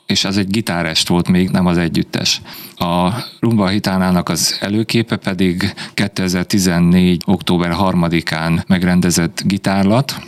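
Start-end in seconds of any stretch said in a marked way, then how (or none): none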